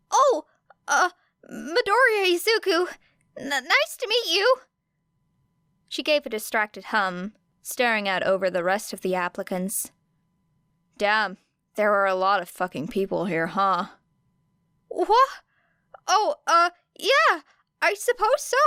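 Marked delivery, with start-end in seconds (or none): 0.1-4.6 put-on high, stammering nervous young man; flustered and eager to please; high-voiced anxious teen boy. A narrator's reading. Nervous boy Midoriya introduces himself. 14.9-18.6 put-on high, startled stammering young man; high-voiced jumpy teen boy; flustered boy. A narrator's reading. Midoriya startled by Era.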